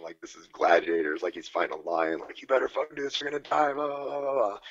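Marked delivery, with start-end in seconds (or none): 2.4-4.6 higher, mock intense coach yelling; imitating a frantic coach, higher. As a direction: ham up a frantic sideline coach. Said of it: "like, this is a life or death situation".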